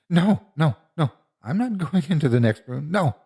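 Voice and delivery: high-pitched panicky refusal; frightened refusal. A narrator's reading. Terrified child refuses to enter room.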